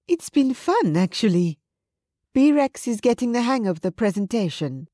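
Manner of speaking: Susanna's voice. A narrator's reading.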